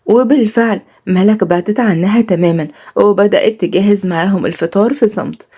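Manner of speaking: ordinary delivery, nothing marked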